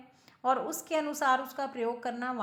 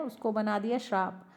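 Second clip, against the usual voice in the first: usual speaking voice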